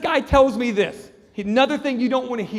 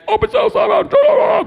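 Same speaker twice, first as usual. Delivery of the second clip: high, loud shouted prison order; yelling guards; 11 dB louder than usual. Retold or imitated. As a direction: holler it down the cell block. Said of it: "They're yelling at each other".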